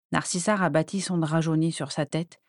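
ordinary delivery, nothing marked

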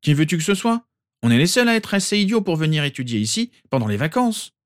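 cheeky mocking reply; Ron's voice. A narrator's reading.

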